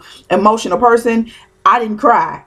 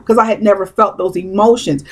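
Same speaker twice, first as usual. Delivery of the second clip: ordinary talk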